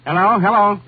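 loud, high hello down the line; a long-distance phone call, hollered. Acted. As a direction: shout into the receiver to get through.

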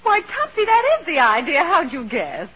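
high, bright and loud: thrilled he guessed it; gleefully springing the surprise; high delighted exclamation. Acted. Ghost cheerfully springs the plan.

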